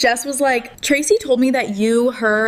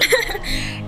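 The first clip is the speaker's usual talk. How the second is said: short laugh; 12 semitones higher, 3 dB quieter than usual. Real, not acted. Laugh after quote.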